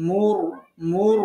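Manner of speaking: মুর said with rounded lips and a full r; heavy r. Shown as a demonstration. Teacher drills students on the example, মুর rounded and full. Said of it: "পোর করে উচ্চারণ করতে হবে".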